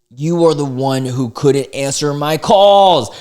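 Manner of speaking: hammed-up nagging voice; mocking girlfriend voice. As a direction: parody her whiny complaint. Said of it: "He goes".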